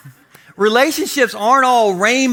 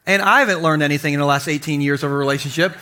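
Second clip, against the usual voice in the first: ordinary talk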